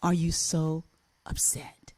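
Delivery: trailing off into a whisper on upset; deep, hushed teacher. Retold or imitated. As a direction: ask softly, dropping to a whisper. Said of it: "She went deep".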